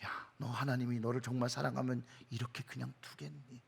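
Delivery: low, hushed, voiced murmur of the tempter; insinuating whisper. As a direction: plant doubt softly, as Satan would. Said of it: "그리고 속삭이죠". (낮게 속삭이는 사단의 목소리)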